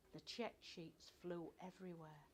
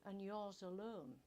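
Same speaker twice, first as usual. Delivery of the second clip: stern boss; 3 semitones higher, 9 dB louder, slower than usual. A narrator's reading. Grimshaw challenges narrator.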